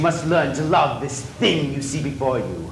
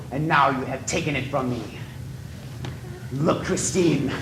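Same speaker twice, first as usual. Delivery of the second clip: ordinary delivery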